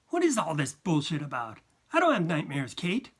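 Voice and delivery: ordinary delivery, nothing marked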